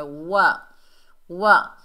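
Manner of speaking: वा as a loud rounded "wa"; fully rounded lips. Shown as a demonstration. Teacher shows the letter waw, rounded वा. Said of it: "होंठों को मुकम्मल गोल करना है".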